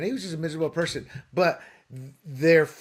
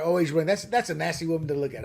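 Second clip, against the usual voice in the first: ordinary talk, nothing marked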